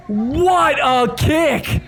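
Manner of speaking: a high, slow, stretched shout; yelling in disbelief, slowly; excited shout. Real, not acted. Reacting to a violent kick.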